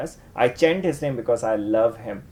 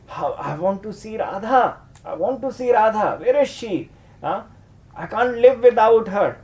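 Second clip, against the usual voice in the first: longing Krishna; 6 semitones higher than usual. Acted.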